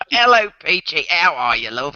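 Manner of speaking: put-on British greeting; mock British accent. Joking with friends about greeting Peachy, mock British accent. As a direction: greet her like a chummy Brit. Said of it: "do it with a British accent".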